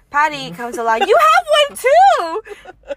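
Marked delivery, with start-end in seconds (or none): 1.1-2.9 excited shout. Retold or imitated. Patty calling out about Coke.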